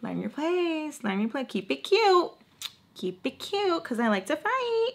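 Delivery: high, soft mock-sweet threat; sing-song warning. Woman warning a newcomer, high soft sing-song.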